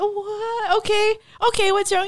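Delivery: tender worried mom checking on her son; soothing mom. Retold or imitated. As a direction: gently ask a teary child what's wrong. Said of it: "And I said".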